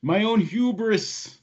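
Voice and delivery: high, slow, grief-stricken lament; remorseful old miner. Acted. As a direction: choke out the self-blame. GM voices grieving Garrick.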